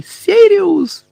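very high, loud scream of a name; anguished high yell; roaring. A narrator's reading. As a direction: scream out for someone lost. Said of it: "заревів Гаррі".

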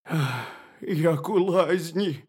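high-ish, drawn-out relieved hare. A narrator's reading. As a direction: sigh it out loudly as a hare. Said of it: "сказав заєць".

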